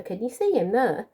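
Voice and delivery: ordinary delivery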